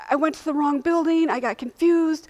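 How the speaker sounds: high, loud wailed excuse; hysterically crying. Retold or imitated. Patient explaining lateness to doctor, hysterical wail. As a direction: imitate a distraught patient pleading to be understood. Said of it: "I'm, like, hysterically crying".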